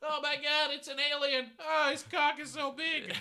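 high, loud alarmed cry; shocked. Acted. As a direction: shriek at the alien. Alien appears, shocked.

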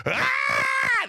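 high and loud joyful yell; excited shout. Retold or imitated. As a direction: squeal with glee. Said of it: "'Cause I was so excited".

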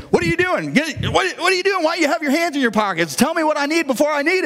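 high, sharp, rapid-fire scolding; impatient scolding dad. Retold or imitated. Dad snapping at his half-asleep son under a car, high and impatient.

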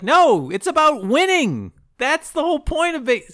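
high-pitched, emphatic mock protest; heated argument. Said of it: "They have an argument".

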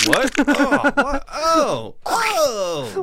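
high, loud startled cries; shocked. Acted. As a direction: act out a big shock, high-pitched. Acting the guy's surprise.

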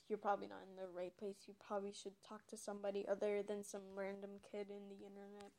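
fading, quiet throwaway aside. Real, not acted. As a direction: say it under your breath. Aside, drops to near silence.

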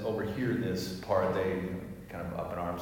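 normal talking voice

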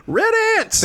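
very high-pitched mock announcement; mocking exclamation. Mimicking a co-host's random tweet, high-pitched mocking. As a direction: shoot the voice way up, poking fun.

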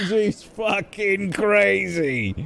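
sneering, high mocking voice; mocking scorn. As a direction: scoff at her disbelievingly. NPC mocks player.

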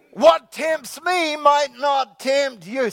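slow, loud preacher shout on the refrain; emphatic refrain. Real, not acted. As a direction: hammer the refrain home, drawn out. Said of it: "preacher repeats refrain".

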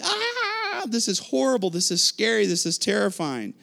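high wail sinking back toward normal pitch; mock terrified scream. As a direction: act out panicked fishermen, starting on a high scream. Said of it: "screaming out".

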